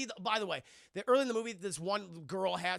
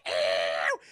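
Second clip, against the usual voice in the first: much lower loud disgusted yelp; mock yelp; 12 semitones lower, 8 dB louder than usual. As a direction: recoil with a yelp. Her reaction shout.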